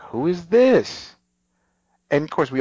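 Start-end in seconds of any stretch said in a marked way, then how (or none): 0.0-1.1 high, slow, wary voice at the door; suspicious quote. Retold or imitated. Emily at her front door, high and suspicious. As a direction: call out through the door, suspicious.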